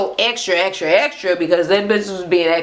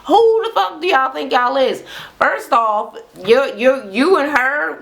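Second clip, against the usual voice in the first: high-pitched indignant outburst; outraged; 5 semitones higher than usual. Real, not acted. Ranting at the show's cast in high-pitched outrage. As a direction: call them out in disbelief.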